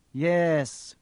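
offhand villain's yes; idle. A narrator's reading. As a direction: answer idly, half attending. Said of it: "said Quirrell idly".

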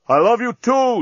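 loud declaration returned at a distance; shouting back. A narrator's reading. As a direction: holler it back across the cells. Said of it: "Dan shouted back".

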